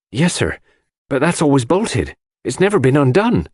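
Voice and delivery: put-on the old servant answers, worried; anxious maid. A narrator's reading. Dorcas answers John about the bolted door.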